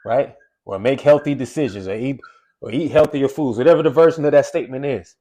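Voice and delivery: ordinary talk, nothing marked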